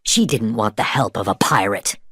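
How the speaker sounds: plain reading voice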